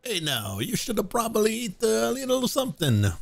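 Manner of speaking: high-pitched mock Italian accent. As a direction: play the line in a hammed-up Italian accent. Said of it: "Read with an Italian accent".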